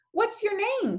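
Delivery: calling out. A narrator's reading. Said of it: "she calls".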